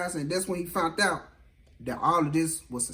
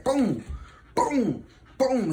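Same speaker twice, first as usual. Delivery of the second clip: three spaced, higher-pitched booms; imitated beating blows; 7 semitones higher, much slower than usual. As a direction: punch out each boom like a landing blow.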